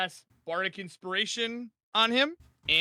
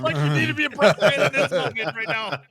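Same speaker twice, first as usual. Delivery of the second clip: loud, laughing insistence; worked up and laughing, voice raised; laughing plea; 3 semitones higher, 10 dB louder than usual. Real, not acted. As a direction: plead through real laughter. Player pleading while laughing.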